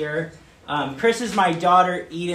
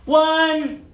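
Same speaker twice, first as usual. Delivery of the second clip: higher, louder, obnoxious counting; over-the-top counting; 8 semitones higher, 4 dB louder, much slower than usual. Retold or imitated. Dad counting for his daughter to hide, over-the-top loud. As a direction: ham up the count so she runs. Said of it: "a little obnoxious, over the top".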